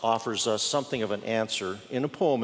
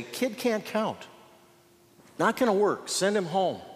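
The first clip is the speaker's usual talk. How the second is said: dismissive disciples; 5 semitones higher than usual. Retold or imitated. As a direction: wave the boy off like a doubting disciple. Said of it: "And the disciples ... say".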